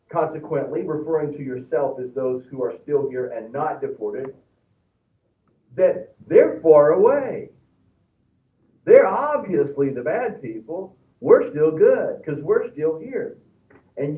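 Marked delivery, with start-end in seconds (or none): 5.7-13.4 loud, slow, self-satisfied sneer; mock the self-righteous; smug mockery. Preacher mocking the people of Jerusalem, smug and loud. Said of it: "And you said".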